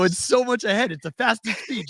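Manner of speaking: high, eager explaining; eager boasting. Retold or imitated. To his wife, bragging about the app speed, high and eager. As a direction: sell it like a kid. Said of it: "I go".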